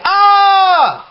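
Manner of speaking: high, held "ah" yell; demonstrated yell. Shown as a demonstration. Showing viewers how these bands yell, high mock yell. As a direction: mimic a bad metal vocalist's yell. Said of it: "yelling, like".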